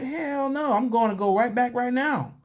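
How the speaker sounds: high-pitched mock outrage; indignant refusal. Acts out man's indignant refusal.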